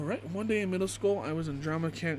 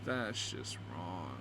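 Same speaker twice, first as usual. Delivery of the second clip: low, slow, dragged-out disapproval; disgusted; 8 semitones lower, 6 dB quieter, much slower than usual. Real, not acted. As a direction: let it sink, appalled. Disgusted reaction.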